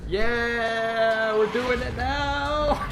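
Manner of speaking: high, drawn-out whoop; loud, high gamer celebration; triumphant cheer. Real, not acted. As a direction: crow with delight, stretching the words. Cheers at success in game.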